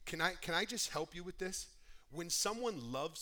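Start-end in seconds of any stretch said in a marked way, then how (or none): none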